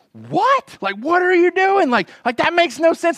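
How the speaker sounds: high panicked co-pilot voice, rising to a yelp; alarmed, high-pitched disbelief; freaking out. Retold or imitated. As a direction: play the co-pilot losing it. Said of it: "he's freaking out".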